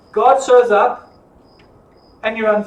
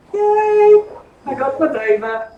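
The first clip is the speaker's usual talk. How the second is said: gleeful cheer; 6 semitones higher, slower than usual. Acted. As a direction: crow it like a spoiled winner. Gleeful cheer at seizing the vineyard.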